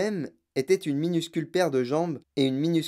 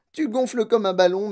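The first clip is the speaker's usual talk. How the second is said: raised, high-pitched exclamation; alarmed cry; 4 semitones higher, 4 dB louder than usual. A narrator's reading. Mother watching daughter inflate, alarmed. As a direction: cry it out in mild horror. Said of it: "hurla madame Beauregard".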